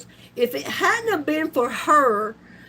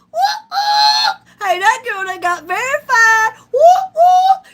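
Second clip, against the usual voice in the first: much higher and louder cheering whoop; excited whoop; 10 semitones higher, 7 dB louder than usual. Real, not acted. Announcing verification to viewers, excited cheering. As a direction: celebrate getting verified, cheering. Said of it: "What y'all think about your girl?".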